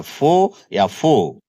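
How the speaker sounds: "फो" with a silent r; correct pronunciation. Shown as a demonstration. Teacher giving students the correct reading, "फो" without any r. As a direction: say "फो" ending on the open o. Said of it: "इसका correct pronunciation होता है".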